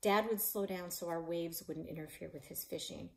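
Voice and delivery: ordinary delivery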